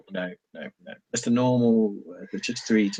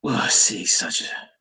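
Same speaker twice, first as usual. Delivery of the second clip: slow, drawn-out beast voice; slow creature threat; 6 semitones lower, 4 dB quieter, much slower than usual. Acted. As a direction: weigh each word like a wolf deciding. Wolf replies to Grace.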